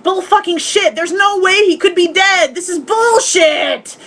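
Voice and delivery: disbelieving shout. Retold or imitated. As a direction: yell at the screen that it's bullshit. Speaker recalls disbelief at funeral.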